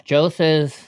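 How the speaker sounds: ordinary talk, nothing marked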